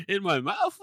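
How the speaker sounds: high, loud questioning shock; mock alarm. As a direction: imitate a horrified patient's question. Acting the wounded man's alarm.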